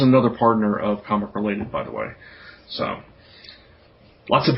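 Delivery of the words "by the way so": normal talking voice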